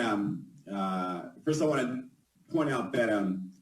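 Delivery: ordinary talk, nothing marked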